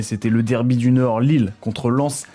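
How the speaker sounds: ordinary talk, nothing marked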